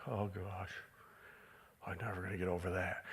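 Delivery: low, soft mock despair, a little fast; mock hopeless sinner. Preacher mocking a listener stuck in a lustful habit, soft and low. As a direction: sigh it out like someone who's given up. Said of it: "you're like".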